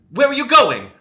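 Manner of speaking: high, loud urgent demand. A narrator's reading. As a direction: call after him to stop him. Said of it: "demanded Catherine".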